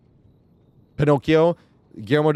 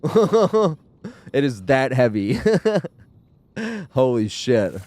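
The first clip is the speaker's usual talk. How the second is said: laughing; 4 semitones higher, much slower than usual. Real, not acted. Laughing about a heavy film.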